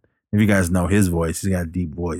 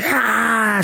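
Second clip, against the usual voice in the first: imitated waking scream. Recapping her waking in hospital, mock scream. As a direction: mimic waking from a nightmare screaming. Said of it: "she wakes up screaming".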